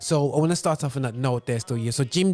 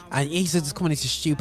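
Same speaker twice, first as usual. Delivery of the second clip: ordinary talk, nothing marked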